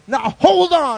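loud, high interruption; acted brother butting in loudly; calling a halt. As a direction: stop the race with a shout. Brother halts the start.

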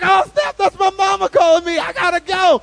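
high, very loud excited shout; acted frantic excitement at full volume; galvanized boy. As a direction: yell it with sudden new energy. Boy hears his mom calling.

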